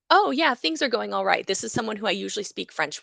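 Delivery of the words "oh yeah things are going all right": cheerful quote. Retold or imitated. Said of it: "I wanted to say".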